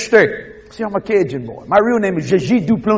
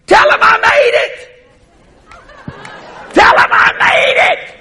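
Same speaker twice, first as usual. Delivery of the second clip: high, straining long-distance shout; hollering; 8 dB louder than usual. Retold or imitated. Late aunt sending word from heaven to her nephew, hollering. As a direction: holler the message so it carries from afar. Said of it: "She was hollering".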